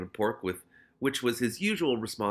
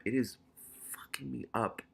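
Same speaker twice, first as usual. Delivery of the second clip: whispering; 4 dB quieter than usual. Real, not acted. Complaining about sudden cold.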